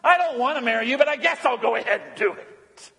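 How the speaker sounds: grudging, put-upon voice; mock reluctant groom. Preacher mocking the groom, grudging put-on voice. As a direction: say it like you're doing her a favour. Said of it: "Sick and pathetic".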